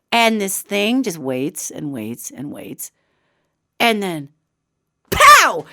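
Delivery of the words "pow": sudden high, loud burst; explosive exclamation. Retold or imitated. Storyteller acting out the creature's leap, explosive. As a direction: punch it out like a jump scare. Story's jump scare sound effect.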